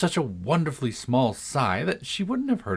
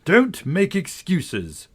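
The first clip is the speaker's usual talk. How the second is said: slow, higher stern guard; 8 semitones higher, 4 dB louder, much slower than usual. A narrator's reading. Guard brushing off Alice's excuse, slow and stern. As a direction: cut off her excuse firmly. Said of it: "said the guard".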